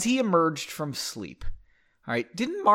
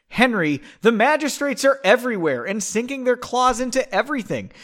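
urgent, higher and louder plea; impassioned, emphatic warning; with conviction; 8 semitones higher, 6 dB louder than usual. A narrator's reading. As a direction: press the danger on the listener. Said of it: "she said with conviction".

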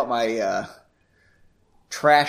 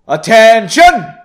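loud, slow drill-sergeant bark; military command; 6 semitones higher, 14 dB louder, slower than usual. Acted. Senior soldier yells at the mage like a drill sergeant. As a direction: snap them to attention. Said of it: "read it like I assume people in the military".

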